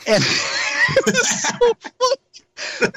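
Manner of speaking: talking through high laughter; real laughter. Real, not acted. Guest recalling the spirit's joke about Aaron, laughing hard. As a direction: crack up while saying it, words stretched.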